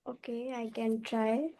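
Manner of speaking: barely audible, far from the mic; speaking much too softly; very quiet. Real, not acted. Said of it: "your voice is very low".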